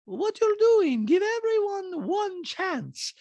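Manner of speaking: very high, slow mock Bosnian accent; Diana's accent impression. Host imitating Diana's talking head, very high Bosnian accent. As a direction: deliver it in a put-on Bosnian accent, deadpan. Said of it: "Diana's like".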